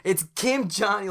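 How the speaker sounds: loud, high brash showman; sudden switch to a big announcement; Kim Johnny. Acted. Calm attendant switching completely, loud brash self-introduction. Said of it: "he just switches completely".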